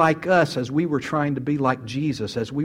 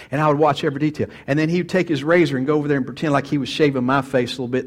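ordinary talk

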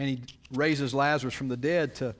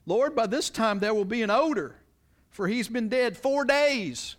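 voicing Martha; 9 semitones higher, 5 dB louder than usual. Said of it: "Martha ... said to him".

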